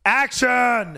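loud, high shout of action; director's call. Shown as a demonstration. Showing how you start a scene, director's call. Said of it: "how do you start a scene".

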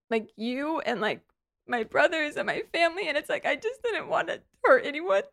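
real tears breaking through; snotty crying. Real, not acted. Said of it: "I have such an ugly crying voice".

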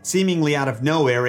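ordinary delivery

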